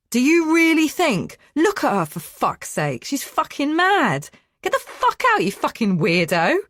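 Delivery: high, loud, exasperated shouting; furious yelling. A narrator's reading. Man shouting at the intruder to leave, furious yelling. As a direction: rant and throw her out.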